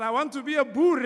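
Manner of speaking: put-on loud, high kid's insistence; childish insistence. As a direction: demand it like a stubborn child. Child declaring to mother.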